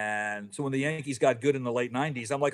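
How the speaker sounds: ordinary talk, nothing marked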